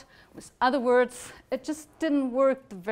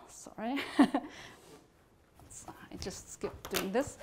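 real soft laugh while admitting a slip; laughing apology; 7 dB quieter, much slower than usual. Real, not acted. Storyteller to listeners after skipping a bit, laughing apology. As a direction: apologise through a quiet chuckle.

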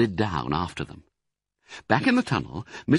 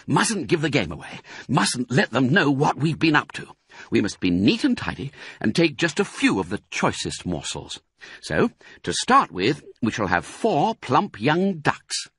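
higher-pitched, measured planning; bossy, careful fox; 6 semitones higher than usual. Acted. Fox picks food for the others, carefully bossy. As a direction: pick out the loot with care.

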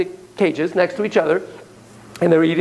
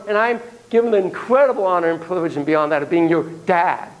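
ordinary talk, nothing marked

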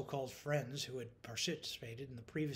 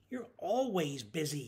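higher, slow whine; whining; 4 semitones higher, 5 dB louder, slower than usual. A narrator's reading. Neglected coworker complaining to her, whining. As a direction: drag it out in a whine. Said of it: "he whined".

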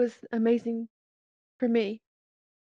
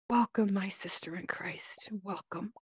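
soft breathy whisper of welcome; unvoiced gentle whisper; whispering pastor; 3 semitones lower, 7 dB quieter than usual. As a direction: hush it like a pastor blessing you. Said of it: "he was saying it in a whispery tone".

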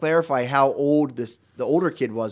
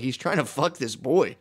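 ordinary delivery

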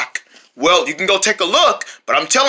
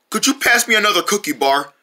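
normal talking voice

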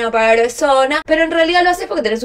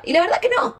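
high-pitched denial; 8 semitones higher than usual. Real, not acted. Emphatic denial, then repeated plainly.

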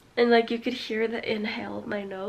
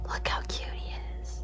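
soft whisper; 7 dB quieter than usual. Real, not acted. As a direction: hush it, barely voiced. Whispering about sleeping dog.

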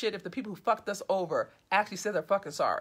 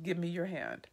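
low, hushed appeal; soft, emotional; 5 semitones lower, 9 dB quieter than usual. A narrator's reading. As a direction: say it softly and low, full of feeling. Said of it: "He speaks softly, his voice with emotion".

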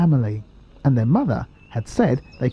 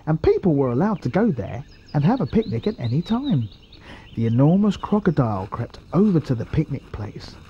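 plain reading voice

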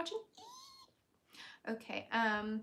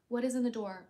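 ordinary delivery

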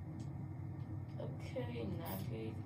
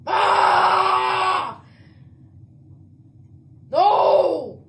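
loud cry of dismay; anguished scream; 6 semitones higher, 12 dB louder than usual. Real, not acted. Ejected gamer screaming at the team in dismay. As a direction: scream as you get ejected. Getting voted out.

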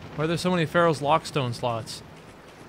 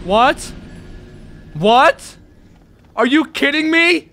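high, loud, incredulous outburst; indignant shouting, pitch shooting up; outraged disbelief; 10 semitones higher, 8 dB louder, slower than usual. Real, not acted. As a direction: build from a shocked question to a yell. Outraged at being killed by petrification.

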